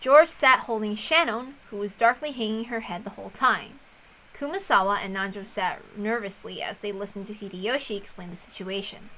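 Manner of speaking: usual speaking voice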